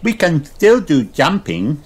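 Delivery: high little piglet voice; Peppa. A narrator's reading. Peppa suggests a game to George, high-voiced. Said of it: "says Peppa".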